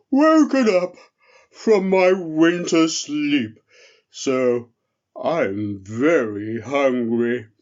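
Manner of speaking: slow, loud, lumbering bear voice; a big drowsy bear just woken, yawning through it; sleepy friendly bear. Acted. The bear asks the elf for soup, slow and sleepy.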